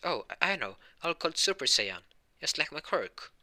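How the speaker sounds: high, eager burst; light up as the name comes to you; sudden idea. Acted. Boy names his new form, pleased and eager.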